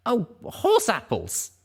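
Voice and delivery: high, drawn-out oh no; sudden dismay. Acted. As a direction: blurt it as it dawns on you. Sudden realization exclamation.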